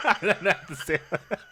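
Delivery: talking through a laughing fit; real laughter. Real, not acted. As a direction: crack up while admitting it. Cracking up at his own story.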